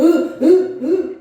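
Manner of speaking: slow, drawn-out sobbing groans; long imitated sob sounds. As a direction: sob it out slowly, still half in the dream. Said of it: "으 이러면서 일어났다니까요".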